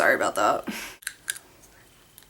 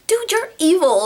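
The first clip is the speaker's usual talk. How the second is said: mock outrage; 11 semitones higher, 4 dB louder than usual. Mom making her laugh.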